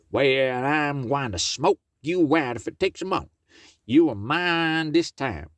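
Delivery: sweet-talking the folks as the fox; high sly fox voice. Acted. Brer Fox greeting the folks from the door, high sly voice.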